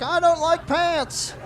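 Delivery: put-on high, slow, stubborn toddler voice; little boy voice. Nephew explains to uncle why pants are off, slow high kid voice. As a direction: drag it out like a defiant little boy. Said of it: "he's just like".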